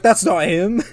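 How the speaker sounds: high, loud mock shock; hammed-up What? of disbelief. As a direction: act out a stunned detective. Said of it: "he's like".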